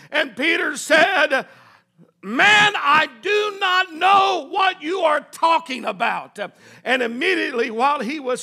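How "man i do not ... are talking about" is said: loud, drawn-out protest, pitched up on Man; vehement denial. Acted. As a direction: shout the denial slowly, word by word. Peter's final denial acted out.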